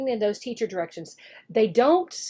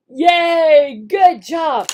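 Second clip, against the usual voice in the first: cheer; 3 semitones higher, 8 dB louder, much slower than usual. Shown as a demonstration. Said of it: "praising pretend student".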